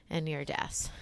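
whispered, mock-reverent tribute; a pretend graveside whisper; mock solemn whisper. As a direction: hush it like a eulogy at a funeral.

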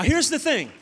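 ordinary talk, nothing marked

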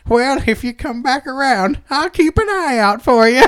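put-on high, folksy old-woman voice; old madam. Old madam chatting with her satisfied customer, in an old-woman voice. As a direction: chat sweetly like a pleased crone. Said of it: "she's all old".

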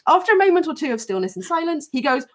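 ordinary talk

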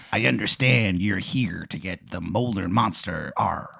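soft, slow pirate growl of a role; pirate tomato. Acted. Pirate tomato briefs Aaron on the monster, slow and soft. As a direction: speak slowly as a hushed pirate. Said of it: "he's a pirate".

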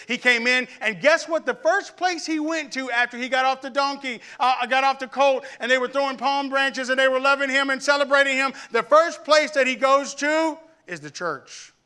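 high, loud preacher build to the church; rising pulpit crescendo, driving each phrase home; preaching at full force. Acted. Preaching climax.

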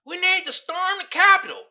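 mock rioter rallying the crowd. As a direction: pump up the mob in a mock voice. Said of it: "he was like".

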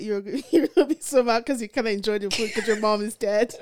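incredulous question through laughter; laughing disbelief. Real, not acted. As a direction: crack up while questioning it. Laughing at absurd reasoning.

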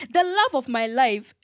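ordinary talk, nothing marked

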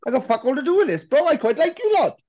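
high, loud comic protest; hammed-up plea to be left out of it; mock pleading. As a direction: beg off in a squeaky put-on voice. Acting out band's plea at hostile sign.

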